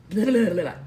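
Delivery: loud burst of blah-blah; frustrated babble. Real, not acted. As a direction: shake off a fluffed line with loud babble. Reader garbles a line, resets.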